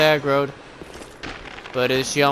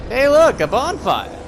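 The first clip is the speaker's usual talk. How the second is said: excited call; 8 semitones higher, 4 dB louder than usual. Real, not acted. Spots a bonfire.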